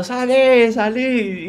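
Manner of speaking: very high-pitched imitated yell; mock yelling. Boyfriend yelling at his grandmother to get out, mock yell. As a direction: act out a frantic high-pitched shooing. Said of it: "el chabón gritando".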